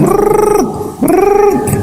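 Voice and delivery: very high trilled brrr; imitated nagging noise. Preacher mimics a wife's endless nagging, high trilled noise.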